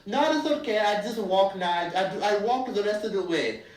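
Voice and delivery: put-on South African accent. Telling the driver they'll walk, in fake South African accent. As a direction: politely decline in a mock South African accent. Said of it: "put on my best South African accent".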